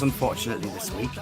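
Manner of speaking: normal talking voice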